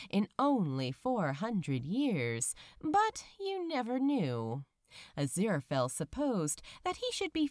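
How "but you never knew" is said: usual speaking voice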